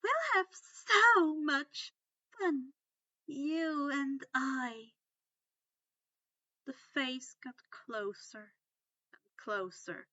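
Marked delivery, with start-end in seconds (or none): 0.0-4.9 high, loud, slow and leering; slow leering menace. A narrator's reading. Umbridge leering close to Harry's face. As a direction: stretch it out like a predator.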